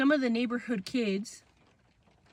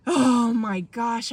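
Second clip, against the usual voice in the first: slow, louder, drawn-out dismay; retold meltdown; 6 dB louder, much slower than usual. Child told to go ask again, drawn-out retold dismay. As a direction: imitate despair, stretching every word. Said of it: "losing it some more".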